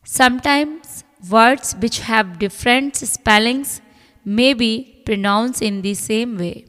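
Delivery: reading the rule aloud very slowly. Acted. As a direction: dictate each word slowly to the class. Reads English rule slowly. (नियम को बहुत धीरे-धीरे पढ़कर सुनाना)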